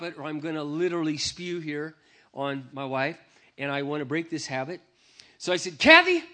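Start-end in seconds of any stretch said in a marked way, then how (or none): 5.8-6.2 shouting her name high and loud; loud call. Retold or imitated. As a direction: yell Kathy across the trailer. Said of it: "just like that".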